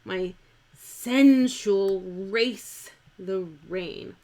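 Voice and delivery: slow, loud verse, "sensual" as SEN-shul; two-syllable sensual. Shown as a demonstration. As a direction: declaim it slowly, "sensual" in two beats. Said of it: "make this word two syllables".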